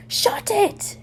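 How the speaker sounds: high snapped yell. A narrator's reading. Harry yelling from his bed at Ron. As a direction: yell it up high to shut him up. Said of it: "Harry yells".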